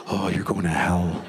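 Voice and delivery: low, ominous voice of doom; mock threat to a small child, drawn out; menacing church. Church menacing a five-year-old.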